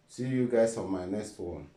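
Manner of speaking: ordinary talk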